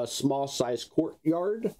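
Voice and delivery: ordinary talk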